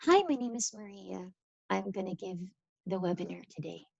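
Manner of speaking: bored, subdued phone voice; unenthusiastic demo. Shown as a demonstration. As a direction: introduce yourself with no energy, trailing down softly. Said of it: "if I was to talk to you like".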